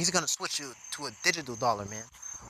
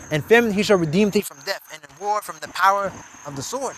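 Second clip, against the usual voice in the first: louder, declaiming the verses; scripture reading; 3 semitones higher, 6 dB louder than usual. Acted. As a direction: proclaim the verses from the pulpit. Reads scripture aloud.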